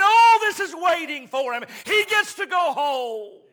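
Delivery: fervent preaching, building from brisk and driving to a loud, high climax; full-force preaching. Real, not acted. Preacher telling the congregation what awaits Jesus, fervent and building. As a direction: preach it with mounting force to a shouted peak.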